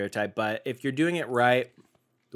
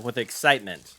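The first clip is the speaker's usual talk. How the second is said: usual speaking voice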